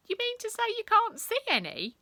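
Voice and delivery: high, loud, feigned surprise; cheeky pupil pretending to be amazed; mock innocent. A narrator's reading. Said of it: "she asked mock innocently".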